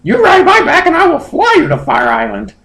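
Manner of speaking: very high falsetto creature; squeaky bird-Pokemon voice; high Moltres voice. Acted. Moltres asking Justin to fly home, squeaky high.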